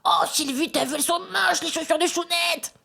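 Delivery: aunt's voice. Retold or imitated. Aunt telling the mother-in-law about the shoes, high squealing imitation. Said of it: "Elle dit".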